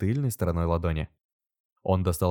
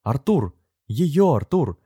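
higher, louder exclamation; amazed recognition; 7 semitones higher, 6 dB louder, much slower than usual. A narrator's reading. Heroine's amazed recognition, narrated.

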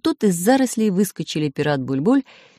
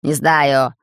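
barking; 5 dB louder than usual. Acted. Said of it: "рявкнул Бульбуль".

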